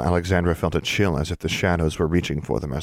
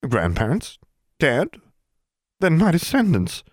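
shocked disbelief; 11 semitones higher than usual. Acted. Portrait learns her line ended.

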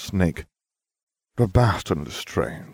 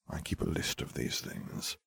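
unvoiced dry whisper; whispered aside; 14 dB quieter than usual. A narrator's reading. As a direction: murmur it like a secret. Sardonic aside in narration.

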